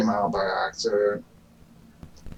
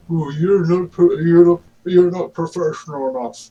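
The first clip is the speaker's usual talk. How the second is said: louder, higher retold put-down; higher, louder imitation of an accuser; mock criticism; 5 semitones higher, 7 dB louder than usual. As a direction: quote the critic in a raised mocking voice. Retells someone's insult to the speaker.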